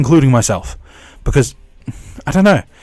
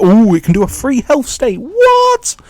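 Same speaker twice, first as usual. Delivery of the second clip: high-pitched, hammed-up amazement; mock excitement; 12 semitones higher, 5 dB louder than usual. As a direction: gush in mock awe, rising to a squeal. Mock hype about a power.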